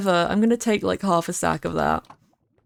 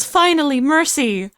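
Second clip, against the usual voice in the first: higher mock-gleeful Finally, mercy; a light cute creature voice, higher; little Luma voice; 6 semitones higher than usual. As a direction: voice the tiny star creature longing for its end. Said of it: "little Luma goes".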